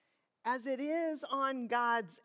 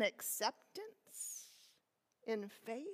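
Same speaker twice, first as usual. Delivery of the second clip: whisper; 8 dB quieter, slower than usual. Acted. Reflective questions trailing softly.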